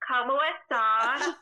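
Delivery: put-on loud, slow Spanish greeting in a Southern accent. Mocking herself teaching Spanish, in a hammed-up Southern accent. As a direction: drawl "Hola, ¿cómo estás?" loud in a Southern accent. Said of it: "I cannot go teach people Spanish with a Southern accent".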